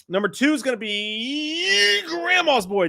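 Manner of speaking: slow, high announcer call for number two; countdown announcer. Acted. Ranking the movies, slow, high countdown call. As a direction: announce it like a top-ten show.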